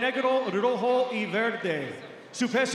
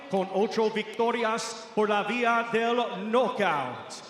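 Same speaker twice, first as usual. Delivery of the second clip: ordinary talk, nothing marked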